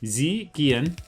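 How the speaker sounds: stiff put-on German accent; mock German. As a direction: try out clumsy German words. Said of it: "I don't know German very well".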